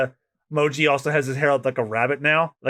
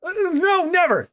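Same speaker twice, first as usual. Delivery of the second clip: much higher, hurried denial; nervous high soldier. Acted. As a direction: answer the boss in a squeak. Marine answers Smoker.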